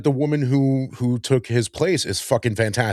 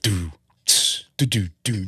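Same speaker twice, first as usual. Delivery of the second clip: sparse mouthed kick and hi-hat; vocal drum beat; 5 semitones lower, 5 dB quieter than usual. Retold or imitated. Imitates slow Def Leppard drumming.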